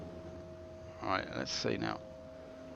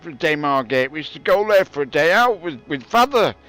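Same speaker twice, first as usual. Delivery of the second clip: put-on grumpy old-timer; 5 semitones higher, 5 dB louder than usual. Old man grumbling to the kids about the old days, loud and high. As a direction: rant like a cranky granddad who had it harder.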